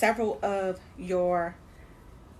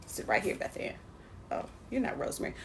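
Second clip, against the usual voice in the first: muttered to herself; 9 dB quieter, faster than usual. Real, not acted. Talking to herbs under breath.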